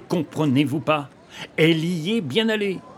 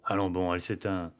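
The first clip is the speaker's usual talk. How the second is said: hushed; 10 semitones lower, 8 dB quieter than usual. Acted. Match dies in dark.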